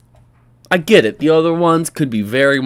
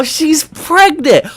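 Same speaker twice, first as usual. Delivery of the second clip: hammed-up slow gasp of discovery, much higher and louder; mock shock; 12 semitones higher, 6 dB louder, much slower than usual. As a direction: stretch "She's pregnant!" high, loud and slow like a fake epiphany. Sarcastic shock over pregnancy.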